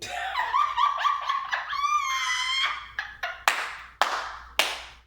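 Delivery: high howling laugh; real shrieking laughter; loud shrieking laugh. Real, not acted. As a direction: burst into a loud cackling shriek. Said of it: "let me calm down".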